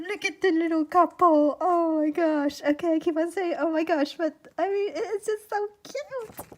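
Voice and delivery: high, loud fangirl squealing; excited gushing. Real, not acted. Recognising a friend's drawing, excited gushing. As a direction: gush at a high pitch, overcome with cuteness.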